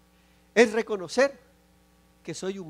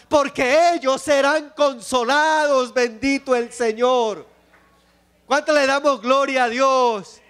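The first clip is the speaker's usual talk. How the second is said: loud, fervent pulpit preaching, pitched higher; sermon climax, voice raised and ringing; full-force preaching; 6 semitones higher, 6 dB louder than usual. Acted. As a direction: preach it to the whole congregation.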